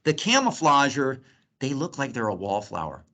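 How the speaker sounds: ordinary delivery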